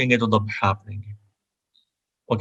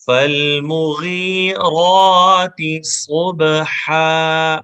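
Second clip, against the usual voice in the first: slow melodic chant, a bit higher; Quranic recitation; 4 semitones higher, 5 dB louder, much slower than usual. Shown as a demonstration. Teacher chanting the verse for students to repeat. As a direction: recite each verse slowly for repetition. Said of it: "اب third آیت پڑھیں گے".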